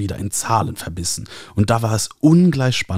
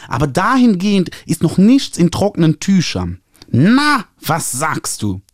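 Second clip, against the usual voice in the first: high, nasal, gushing publisher voice; 7 semitones higher, 3 dB louder than usual. A narrator's reading. Publisher Ernie calling the author, high nasal gushing. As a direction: trumpet it through your nose like an eager publisher. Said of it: "Ich hörte ihn tief einatmen".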